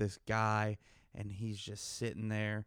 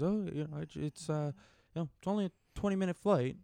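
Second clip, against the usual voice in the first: higher, fast mock Midwestern excuse; Midwestern accent; 5 semitones higher than usual. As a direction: play the nice Midwestern guy, higher. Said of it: "has this, like, Midwestern accent".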